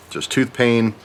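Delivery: ordinary talk, nothing marked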